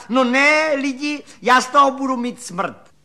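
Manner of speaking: high, loud narrated shriek of hilarity; křik ve smíchu. A narrator's reading. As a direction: cry out high, as if helpless with mirth. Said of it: "pištěla a kašlala a křičela". (vykřiknout vysoko a hlasitě)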